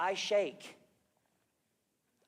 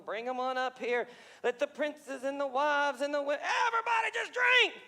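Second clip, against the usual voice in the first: put-on rowdy drunk king; 7 semitones higher, 4 dB louder than usual. Drunken king ordering party.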